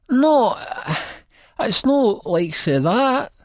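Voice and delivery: high, slow flustered denial; pleading protest. A narrator's reading. Narrator's character pleads with the angry lassie.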